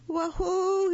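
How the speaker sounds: high loud yelp for help; crying out. Acted. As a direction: cry out from inside the can. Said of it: "he cried".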